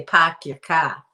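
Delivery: loud, drawn-out Boston accent; put-on Boston "pahk yah cah". Shown as a demonstration. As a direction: drop the Rs like a Bostonian. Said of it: "They say".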